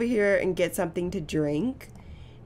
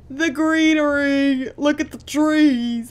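higher, louder, slow delighted gushing; 7 semitones higher, 6 dB louder, slower than usual. Real, not acted. Player showing fans the island greenery, slow excited gushing. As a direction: marvel at the trees like you can't believe it.

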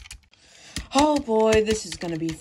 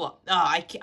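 ordinary delivery

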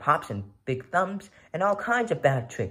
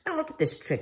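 high, bright showman cat calling out a trick; showy cat; 14 semitones higher, faster than usual. Acted. Cat in the hat to the children, showing off. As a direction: present the trick like a proud showman.

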